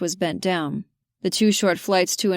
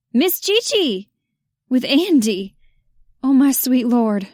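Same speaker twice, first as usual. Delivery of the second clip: put-on excited child; 5 semitones higher, 5 dB louder, slower than usual. Andy shouts excitedly at Georgia.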